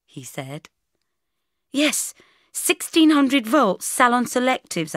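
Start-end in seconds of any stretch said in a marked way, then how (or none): 1.7-5.0 high, slow, emphatic boast; proud. Acted. Bridget to Mark about her hairdryer, proud. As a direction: show it off proudly. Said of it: "I said proudly".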